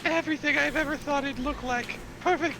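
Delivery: high, thrilled recruit voice; delighted pirate recruit. Acted. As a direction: gush like a would-be pirate over the costume.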